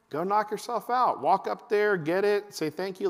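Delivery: acted dad's go-ahead; breezy dad. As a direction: send the kid off briskly. Dad sending kid off, acted.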